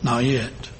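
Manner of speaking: a kind potter quietly refusing; soft low steady "not yet"; gentle firm master. A narrator's reading. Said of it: "simply said".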